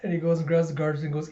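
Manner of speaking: usual speaking voice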